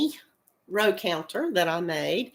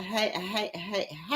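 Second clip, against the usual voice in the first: ordinary talk, nothing marked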